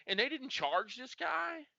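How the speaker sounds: high, slow incredulous question; retold disbelief. Sergeant asking about the unpunished culprit, slow incredulous. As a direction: ask it like you can't believe it.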